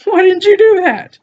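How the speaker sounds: rising incredulous cry at the screen; incredulous outburst. Retold or imitated. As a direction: voice jumps high in disbelief. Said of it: "And I'm like".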